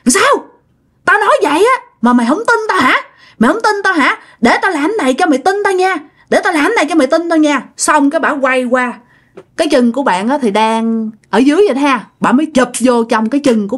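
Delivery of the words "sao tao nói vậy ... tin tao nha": mock yelling. Ghost woman confronts the doubting passenger, high mock yell. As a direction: challenge them sharply, pitch jumping up. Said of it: "bà mới gào lên".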